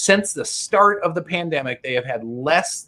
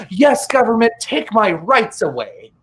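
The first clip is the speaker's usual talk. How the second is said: mock submissive citizen; 6 semitones higher than usual. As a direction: plead it like a sheep.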